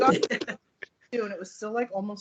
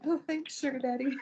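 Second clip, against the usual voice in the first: higher sweet teasing thanks; flirty mock thanks; 6 semitones higher than usual. Thanking her husband, lightly higher teasing coo. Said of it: "I was like".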